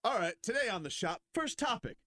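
hammed-up talk-show host voice; mock show host.

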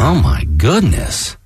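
slow, drawn-out shock; stunned. Real, not acted. Co-host absorbing the breaking Robert Kraft story, stunned. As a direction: stretch it out, taking in the news.

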